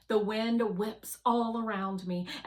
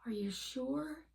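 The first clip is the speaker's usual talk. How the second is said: higher, whispered doubt; small worried whisper; 6 semitones higher, 5 dB quieter than usual. Acted. Fly doubting the rover's confidence, whispering. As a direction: ask it under the breath, afraid of the answer.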